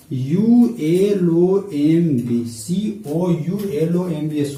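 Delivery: slow letter-by-letter spelling of यू एल ओ एम बी; spelled out letter by letter. Shown as a demonstration. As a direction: spell out सीओ यू एल ओ एम बी एस one letter at a time, slowly.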